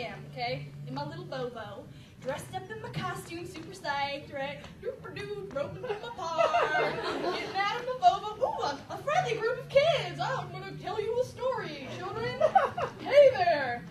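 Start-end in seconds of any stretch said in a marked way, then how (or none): 4.8-12.4 bright, bouncy storyteller greeting, pitch up; hammed-up cheerful park storyteller; chirpy sing-song. Acting out her cheery arrival. 13.0-13.8 put-on big, loud call to the kids; hammed-up loud greeting to children; loud cheery hello.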